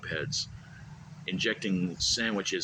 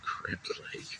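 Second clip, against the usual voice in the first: unvoiced whisper of the words; sarcastic whispered jab; whispered aside; 8 dB quieter than usual. Real, not acted. Muttered mock aside.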